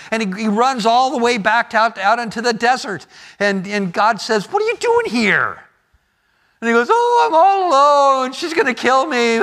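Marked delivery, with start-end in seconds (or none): 4.5-5.6 high, far-off voice of God asking; God's voice. Acted. God voiced in retold story. 6.9-9.4 high, louder despairing wail; whining Elijah. Acted. Elijah whining in retold story.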